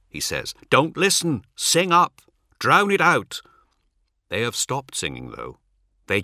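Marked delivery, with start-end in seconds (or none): 0.7-3.4 high, loud rallying orders; urgent command. Acted. Ol rallies pilgrims against the voices, urgent command. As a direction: call out urgently to rally the group. Urging companions amid demonic voices.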